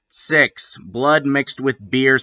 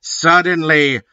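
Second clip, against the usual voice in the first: ordinary talk, nothing marked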